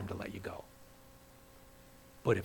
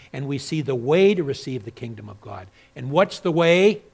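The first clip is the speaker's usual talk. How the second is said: louder and higher, building to a strong question; preaching; 4 semitones higher, 10 dB louder than usual. Acted. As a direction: drive the point home to the congregation.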